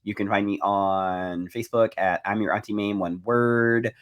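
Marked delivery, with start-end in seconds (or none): none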